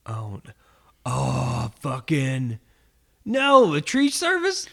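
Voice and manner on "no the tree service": surprised. Real, not acted. Guesses the tree company, astonished.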